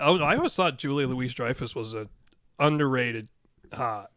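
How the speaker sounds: normal talking voice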